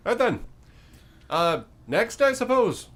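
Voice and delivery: high, loud, oddly cheerful creature; a chipper monster eager to bargain. Acted. GM's bone creature answers the party, high and chirpy.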